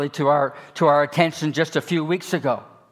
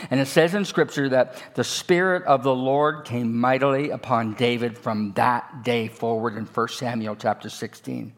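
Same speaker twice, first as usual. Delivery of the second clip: ordinary talk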